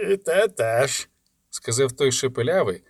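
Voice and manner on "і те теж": slow, menacing drawl; menacing lisping thug. A narrator's reading. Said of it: "в голосі його було щось таке".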